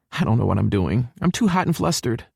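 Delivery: put-on high-pitched, flustered girl's voice. A narrator's reading. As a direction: fret in a high female voice. Hallie frets before meeting mother.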